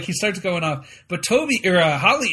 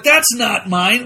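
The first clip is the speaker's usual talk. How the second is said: slow denial; 4 semitones higher, 8 dB louder, much slower than usual. Retold or imitated. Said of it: "Michael's like".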